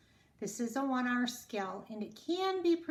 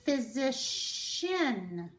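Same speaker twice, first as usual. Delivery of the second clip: "Physician" said very slowly; slow demo word; much slower than usual. Shown as a demonstration. Teacher presenting the word slowly. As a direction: stretch out "Physician". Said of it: "C I A N".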